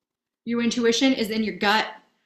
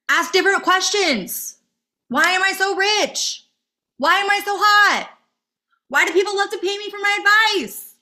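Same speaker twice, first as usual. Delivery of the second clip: much higher and louder, bursting with energy; excited; 10 semitones higher, 4 dB louder than usual. Real, not acted. Coach getting carried away with rich, happy affirmations, excited and high. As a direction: pump up the joy. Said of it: "I know I'm getting really excited".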